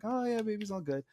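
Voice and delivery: lightly voiced nurse brushing it off; breezy nurse voice. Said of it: "and like".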